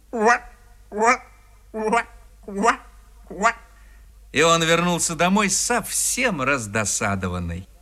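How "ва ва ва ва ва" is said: short high frog croaks; croaking frogs. A narrator's reading. Frogs call after the departing peasant, croaking. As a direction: croak after someone walking away. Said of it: "продолжали кричать ему вслед".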